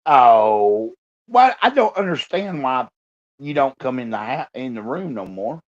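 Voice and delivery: ordinary talk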